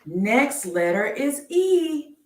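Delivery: slow sing-song reveal of the next letter; drawn-out announcement. Acted. Slow game-show style letter reveal.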